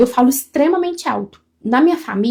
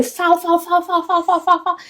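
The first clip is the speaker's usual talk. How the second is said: talking nonstop; 6 semitones higher, faster than usual. Shown as a demonstration. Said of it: "quando eu desando a falar".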